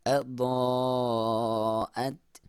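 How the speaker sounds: slow tajweed demo; clear dad. Shown as a demonstration. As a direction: stretch أضاءت with a crisp dad. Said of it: "ضا کو clear ہو".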